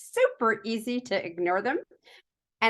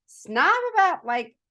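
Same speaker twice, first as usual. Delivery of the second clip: mock snobby squeal; 8 semitones higher, 4 dB louder than usual. Real, not acted. Mocking her own snobbery.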